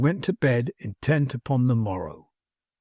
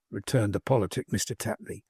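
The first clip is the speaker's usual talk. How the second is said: ordinary delivery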